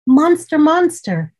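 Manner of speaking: high, loud call into the dark room. Acted. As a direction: call out for the monster. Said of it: "Zuri cried out again".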